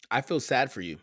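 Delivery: ordinary talk, nothing marked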